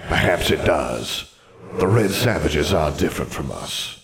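echoing ghostly wizard voice; formal spectre with a hollow reverb; solemn ghost. Acted. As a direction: recount it gravely as an old phantom. Ghost describes banishment.

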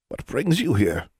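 lightly voiced old witch, mildly curious; a sleepy old headmistress greeting; elderly witch. Old headmistress greets Mister Brown at the doorway, lightly voiced sleepy witch. Erglass speaks at the door.

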